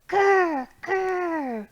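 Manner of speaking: light playful grr grr; bear grr. Acted. Teacher growling along in the song, mock grr. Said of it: "you're gonna go, Grr".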